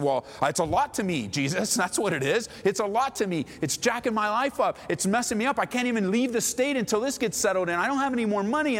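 high, worked-up complaining; acted aggrieved pleading; exasperated protest. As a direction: pile up grievances, pitch climbing. Acted protest back to Jesus.

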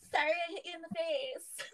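high mock sorry through laughter; laughing apology. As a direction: apologise while cracking up.

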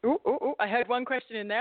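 high, excited little oohs; eager interjection. Real, not acted. Guest grabbing one question from overlapping hosts, real eagerness. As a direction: jump in like you want the question.

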